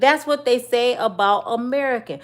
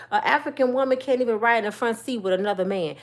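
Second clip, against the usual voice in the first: normal talking voice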